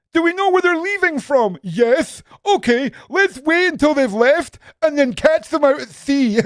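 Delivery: loud, very high mocking question and answer; high-pitched sarcastic ranting; mocking exasperation. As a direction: shout the stupid plan in mockery. Sarcastically acting out the plan.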